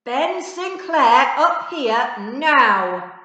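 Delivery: very loud, high, slow bellow; angry teacher shout. Acted. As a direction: roar across the classroom. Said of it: "Mister Archer's angry voice".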